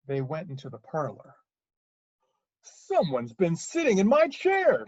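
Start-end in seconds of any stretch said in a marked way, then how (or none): 2.6-4.9 loud, high bear bellow; big emphatic Papa Bear voice; great huge Papa Bear. Acted. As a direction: announce it as a big indignant bear. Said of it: "said the Papa Bear in his great".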